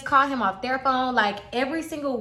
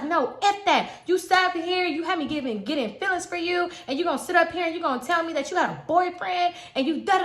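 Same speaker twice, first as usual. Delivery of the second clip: retold anger; 7 semitones higher than usual. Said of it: "this is where the anger came out".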